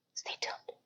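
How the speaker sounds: breathy whispered stay tuned; whispered tease. Real, not acted. Vlogger whispering a teaser to viewers.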